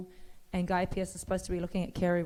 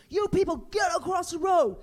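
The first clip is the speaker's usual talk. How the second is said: mimicking a cop; 10 semitones higher, 4 dB louder than usual. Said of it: "The cop's standing in the middle of the road going".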